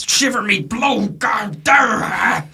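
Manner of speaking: high, slow, loud pirate bellow; a pirate captain jumps up and yells in shock; startled pirate shout. Acted. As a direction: yelp a shocked pirate curse.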